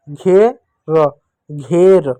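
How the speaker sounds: very slow र then घेर; slow sounding out. Shown as a demonstration. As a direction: stretch it for children. (बहुत धीमा जोड़कर)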